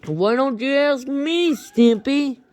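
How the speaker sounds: lightly squeaky Mister Horse. Retold or imitated. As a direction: tease Stimpy up high. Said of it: "Mister Horse says something like this".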